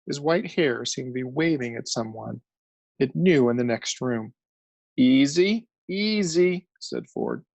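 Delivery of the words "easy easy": rising, calming easy; soothing. A narrator's reading. Ford calming the raving journalist. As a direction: settle him down gently. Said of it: "soothing hand on his shoulder".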